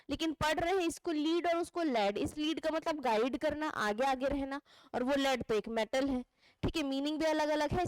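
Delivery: ordinary talk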